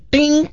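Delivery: high, sharp ding like a typewriter bell; ping it out like the carriage bell. Retold or imitated. Telling how he typed the letter, a high mock typewriter ding.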